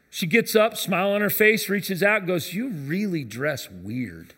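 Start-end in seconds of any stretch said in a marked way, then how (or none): 2.5-4.3 slow, lightly voiced old lady, deadpan; slow elderly lady. Old church lady tells the singer bluntly, lightly voiced and slow. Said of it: "I see this elderly lady".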